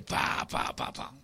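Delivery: soft unvoiced smacking kiss noises; kissing sounds. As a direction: mimic quick little kisses. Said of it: "Empieza a besarle". (imitando besitos suaves y sin voz)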